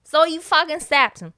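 loud, punchy stab threat; loud mock threat. Mark threatens Rob before the stab, loud mock threat. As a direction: bark it out like a killer.

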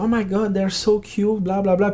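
high mock-gushing tourist voice. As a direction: coo like excited tourists. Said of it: "il y a des touristes".

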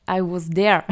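English accent, hammed up for fun; mock English accent. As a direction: put on a playful English accent for "I was there". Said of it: "avec mon super accent anglais". (accent anglais exagéré pour rire)

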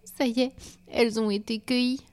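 high, tearful little lament. A narrator's reading. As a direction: say it as if starting to cry. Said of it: "pleura-t-elle".

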